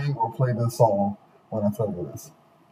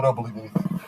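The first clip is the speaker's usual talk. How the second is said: ordinary talk, nothing marked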